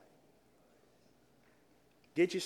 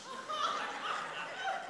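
high burst of laughter; 9 dB quieter than usual. Real, not acted. Laughs at his own joke.

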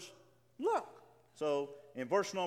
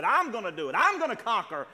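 louder with each claim, hammed-up bragging; boastful conqueror; 4 semitones higher, 10 dB louder than usual. Preacher mocking would-be leaders of mankind: mock boastful bragging, growing louder. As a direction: build the brag louder line by line. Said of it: "people who have said".

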